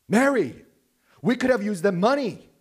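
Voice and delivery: high, loud indignant protest; upset outcry. Retold or imitated. Disciples protesting to Mary about the wasted money, upset. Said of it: "They were upset".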